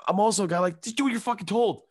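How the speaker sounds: high, emphatic rant; fired up. Real, not acted. Comedian ranting about vaccines, high and heated. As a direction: push it hard and high.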